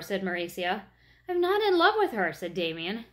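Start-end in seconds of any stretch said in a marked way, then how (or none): 1.3-2.3 higher, louder, insistent denial; protesting shout. A narrator's reading. Damien denies it to the teasing girls, loud and high. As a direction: cry it out against the teasing.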